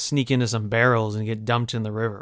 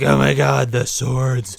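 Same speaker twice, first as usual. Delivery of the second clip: mock panic; slower than usual. Hundreds of goblins spotting two swords, hammed-up alarm. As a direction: gasp it out in theatrical dread. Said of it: "they're like".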